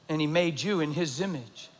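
usual speaking voice

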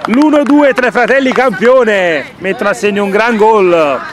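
high, loud shout of the name; goal commentary. Acted. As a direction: yell the scorer's name. Calls a goal in play-by-play. (telecronaca gridata)

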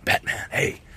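breathy hushed coaxing; whispered dad voice. Retold or imitated. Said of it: "got the dad voice on".

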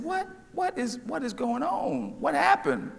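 high, soft imitated boy asking urgently; hushed, high-pitched mock alarm; anxious brother. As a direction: play the worried kid brother, small and high. Brother's alarmed question in joke.

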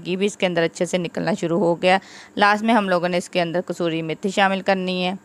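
ordinary talk

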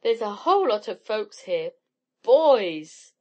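loud, eager announcement; excited shout building to a cheer; excited cry. A narrator's reading. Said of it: "cried Helen".

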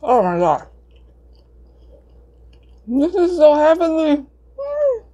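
high delighted swoon; blissful. Real, not acted. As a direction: melt into the taste. Savouring the pizza.

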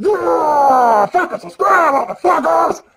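high, shouted sign-off; brash outro shout; rowdy shout. Real, not acted. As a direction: yell it at the viewers.

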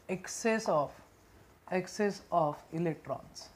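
very slow, broken into short chunks; dictation. Shown as a demonstration. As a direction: dictate word by word with long pauses, repeating. Dictating a definition for writing.

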